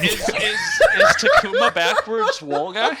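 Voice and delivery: high, slow words through laughter; laughing question. Real, not acted. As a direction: ask it while cracking up.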